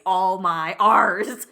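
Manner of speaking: "R's" drawn out with an over-hard American R. Retold or imitated. Mocking foreign actors trying extra hard, over-hard R's. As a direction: stretch "all my R's" slowly, leaning hard on the R. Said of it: "they hit their R's too hard".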